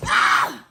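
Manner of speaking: a sharp breathy gasp of alarm; startled gasp. Real, not acted. Gasps in real fright at the tipping can. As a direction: gasp as the can tips over. Nearly knocks over her Sprite.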